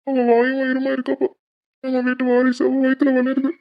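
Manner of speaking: high tearful plea to his father; அழுது கெஞ்சுதல். Acted. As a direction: voice lifted and wet with tears, begging. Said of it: "Prabha, எதுக்கு அழுகுற?". (அழுகையுடன் உயர்ந்த குரலில் கெஞ்சுதல்)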